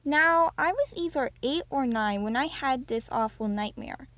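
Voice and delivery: usual speaking voice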